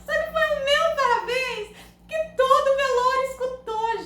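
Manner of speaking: laugh-cry through the line; squeaky with laughter; laughing while telling. Real, not acted. Laughing while recounting the gaffe.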